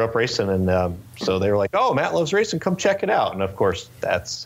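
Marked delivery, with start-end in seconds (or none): 1.7-3.3 high-pitched retold enthusiasm; upbeat quoted invite; eager invitation. Retold friends inviting him to race.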